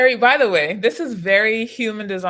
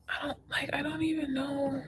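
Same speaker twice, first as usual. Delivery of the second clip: whispered, holding back tears, much softer; near tears; 6 semitones higher, 9 dB quieter than usual. Real, not acted. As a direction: voice catching as she fights off crying. Upset vlogger breaking down.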